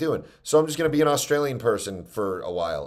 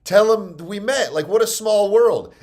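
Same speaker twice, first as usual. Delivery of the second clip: higher, lively voice of a friendly acquaintance; imitating an eager woman, pitched up; enthusiastic gal; 9 semitones higher, 5 dB louder than usual. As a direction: lightly act out her chatty excitement. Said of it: "she was like".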